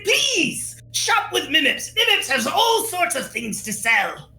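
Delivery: high, eager little merchant creature; Mimips. Acted. Merchant begging his first customers to buy, eager creature voice. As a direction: plead in a high critter voice.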